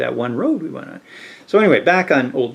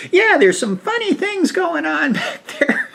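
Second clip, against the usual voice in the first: lightly voiced wife, much higher; a hint of a gossipy neighbour; knowing wife. Wife hinting to the visitors about odd goings-on, higher.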